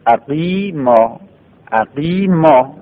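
اقیما stretched out very slowly; slow model word. Shown as a demonstration. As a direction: drag out اقیما for the students. (اقیما را آهسته و کشیده بگو)

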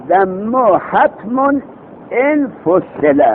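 very slow chanted reading of the verse; slow chanted grammar verse; slow verse recitation. Shown as a demonstration. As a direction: draw out each word of the line.

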